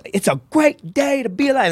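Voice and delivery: high-pitched coach impression; squeaky-high coach motto; coach's voice. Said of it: "He was just".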